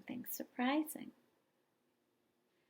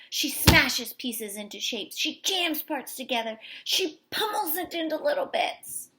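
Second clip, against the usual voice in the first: building angry narration; 4 semitones higher, 7 dB louder than usual. A narrator's reading. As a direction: let the rage build with each smash. Angry girl's building rage narrated.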